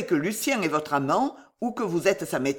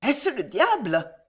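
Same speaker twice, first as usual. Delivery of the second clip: high, slow voice of a frightened woman; fearful question; 6 semitones higher than usual. A narrator's reading. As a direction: draw the question out high, in dread. Frightened Esther questions Lucien. (question effrayée, voix aiguë)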